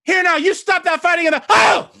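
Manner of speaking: high, shrill townswoman shouting down from her window; busybody neighbour yelling, cut short by a gasp; scolding old lady. Acted. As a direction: scold the brawlers, then break off in shock. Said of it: "and she goes".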